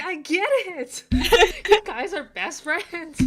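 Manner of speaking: loud, high laughing groan of exasperation. Retold or imitated. Reader mocking the book, laughing and exasperated. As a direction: laugh it out, fed up with the characters. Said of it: "I'm like".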